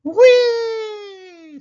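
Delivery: very high, loud held squeal of joy; gleeful whee. A narrator's reading. Baby riding along in the runaway car, gleeful. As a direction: ride the thrill like a rollercoaster. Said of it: "said Baby Lincoln".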